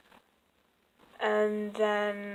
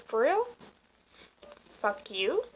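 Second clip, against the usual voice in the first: a little pissed; 3 semitones higher, 4 dB quieter than usual. Retold or imitated. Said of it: "so I got a little pissed".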